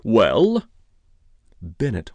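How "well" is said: loud, impatient demand; glowering demand. A narrator's reading. Detective pressing Ira Bennett for an answer, demanding. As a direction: demand an answer sharply and loudly. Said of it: "glowered at Ira Bennett and demanded".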